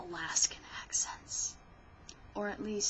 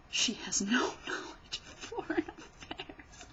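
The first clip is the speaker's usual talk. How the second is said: foreign whispered with a laugh; 3 semitones higher than usual. Shown as a demonstration. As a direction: giggle it out. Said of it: "so I can't really say definitively".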